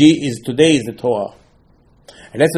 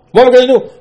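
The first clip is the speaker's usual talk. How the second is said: desperate cry; 11 semitones higher, 9 dB louder, faster than usual. Acted. Moshe in despair.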